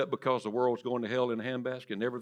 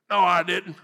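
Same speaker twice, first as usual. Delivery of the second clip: defensive denial; 5 semitones higher, 9 dB louder than usual. Pretend. Congregant denying rebuking Jesus.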